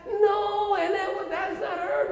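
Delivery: high, loud cry of protest; acted protest. Imagined protest to the whole class.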